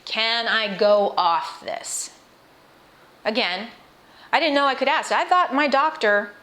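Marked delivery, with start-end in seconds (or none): 0.0-2.1 slow careful question. Retold or imitated. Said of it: "I asked a question".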